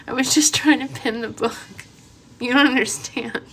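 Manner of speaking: giggling helplessly; laughing through words. Real, not acted. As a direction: tell it while cracking up. Laughing fit over filter.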